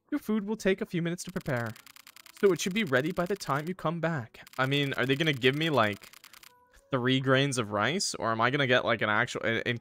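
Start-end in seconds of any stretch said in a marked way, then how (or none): 0.1-4.4 lightly voiced server, pitched higher; takeaway worker. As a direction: explain politely in a put-on voice.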